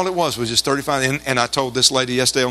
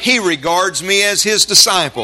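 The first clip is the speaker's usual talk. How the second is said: higher, louder call-and-response preaching; preaching declaration; 8 semitones higher, 5 dB louder than usual. Real, not acted. Preacher having congregation repeat walking with God, forceful declaration. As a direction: lead the congregation phrase by phrase, forcefully.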